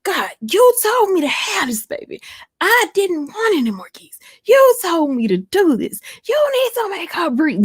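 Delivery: high, pleading holler, retold; yelling at God. Mother retelling how she hollered at God, high pleading yell. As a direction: cry out to God to make her breathe. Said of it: "I started yelling at God".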